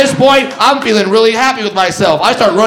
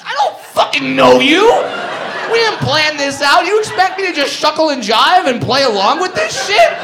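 furious inner rant; angry retort; 4 semitones higher than usual. Retold or imitated. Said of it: "it's like".

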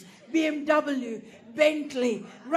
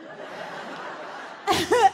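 airy voiceless burst of laughter; wheezing laugh; breathy laugh; 6 semitones higher than usual. Real, not acted. Laugh after the doorman's refusal.

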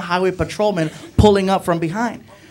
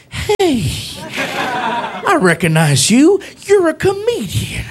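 cop recognizing; 7 semitones higher, slower than usual. Retold or imitated. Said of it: "He's like".

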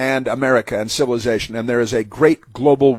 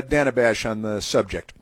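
ordinary talk, nothing marked

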